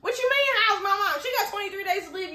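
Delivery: high-pitched mock outrage; indignant outburst. Shouted outrage at the screen.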